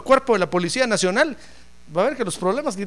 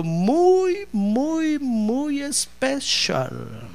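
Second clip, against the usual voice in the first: slow, dragged emphasis on especial; drawn-out emphasis; 4 semitones higher, much slower than usual. Acted. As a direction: stretch each muy slowly. Preacher stretches muy especial.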